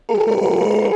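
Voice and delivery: high, drawn-out "ooh"; a scandalized arena reaction; long mock-shocked crowd ooh; imitated crowd "ooh". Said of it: "everyone's like".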